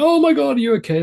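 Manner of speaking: hammed-up worried gasp of a mum fussing over a fallen kid; mock panicked fuss. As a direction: overreact to a child's fall, mock fretting. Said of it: "if she'd made a big deal".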